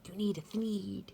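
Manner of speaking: hushed, sly slogan; soft sales pitch. Acted. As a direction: murmur the slogan like a secret.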